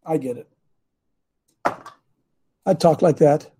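normal talking voice

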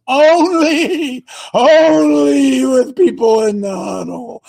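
loud, drawn-out mock shout; slow mock shout. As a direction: shout it slowly for a laugh. Slowed, shouted punchline.

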